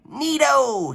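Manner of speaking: high, gleeful goblin cry; goblin's glee. A narrator's reading. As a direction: delight at grabbing the wand. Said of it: "he cried".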